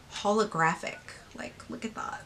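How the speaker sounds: normal talking voice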